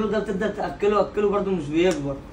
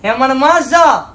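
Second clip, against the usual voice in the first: louder slow call to mother; calling out for mom; 5 semitones higher, 10 dB louder, much slower than usual. Pretend. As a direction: yell for mom across the house. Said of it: "تقول لها يعني بالانجليزي". (نداء عالي وبطيء على الأم)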